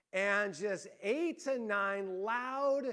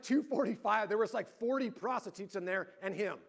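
ordinary delivery

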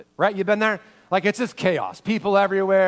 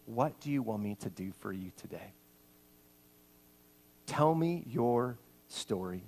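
tender question; 7 semitones lower, 9 dB quieter than usual. Acted. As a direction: lean close and ask gently. Jesus comes to his face.

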